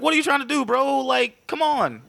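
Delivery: high mock-confrontational taunt; incredulous challenge. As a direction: challenge him in a high voice, stretching the ending. Acted challenge in retold fight.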